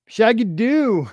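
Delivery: high, loud, drawn-out cheer; excited shout-out. Real, not acted. Streamer hails a viewer by nickname, excited shout-out. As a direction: holler the nickname, stretched out.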